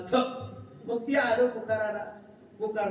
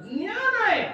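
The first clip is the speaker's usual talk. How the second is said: mock preacher; 14 semitones higher, 6 dB louder than usual. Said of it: "काय महाराज म्हणतात".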